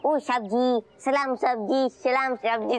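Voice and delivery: lightly voiced Nepali watchman greeting guests; a hint of a hotel doorman's salute. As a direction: play a Nepali watchman a little. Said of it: "Nepal के hotel के बाहर watchman".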